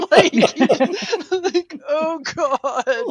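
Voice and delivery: laughing oh God. Retold or imitated. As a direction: giggle out mock panic. Laughing at own panic.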